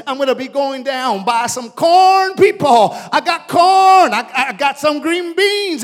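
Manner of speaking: loud market hawker cry, peaking on corn; excited mom. Acted. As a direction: shout your produce across the market. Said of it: "mom is already saying".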